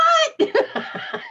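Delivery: squealing high laugh; high burst of laughter. Real, not acted. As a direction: break into a sudden high laugh. Laughs at dog's reaction.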